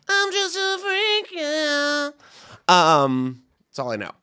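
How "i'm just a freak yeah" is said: mock singer. Imitating the singer's lyric.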